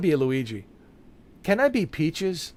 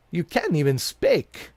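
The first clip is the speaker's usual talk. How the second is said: ordinary talk, nothing marked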